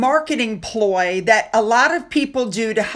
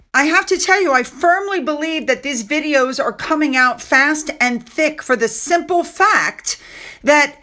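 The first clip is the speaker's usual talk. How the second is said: ordinary talk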